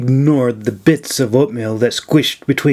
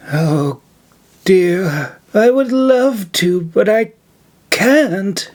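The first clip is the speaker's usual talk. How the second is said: put-on higher old woman's regretful lament; 6 semitones higher, slower than usual. A narrator's reading. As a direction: sigh out the refusal sadly. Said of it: "she lamented".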